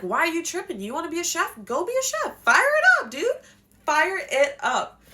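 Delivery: high, loud, pumped-up cheer; hyped encouragement. Retold or imitated. Parent hyping up the child to become a chef, loud and excited. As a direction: yell it like a hype man. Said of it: "you're like".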